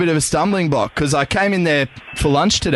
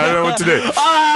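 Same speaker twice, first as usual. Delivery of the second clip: panicked mate; faster than usual. Retold or imitated. Mate panicking as the angry guy runs at them. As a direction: freak out like a scared mate. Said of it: "my mate's like".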